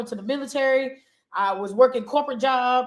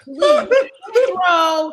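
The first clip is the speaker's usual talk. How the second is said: usual speaking voice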